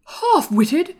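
high, loud, indignant echo; angry. A narrator's reading. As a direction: throw it back in outrage. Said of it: "said Colin angrily".

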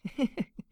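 put-on high-pitched drunken giggle; tipsy little titter; giggling. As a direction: let out a quick, high giggle.

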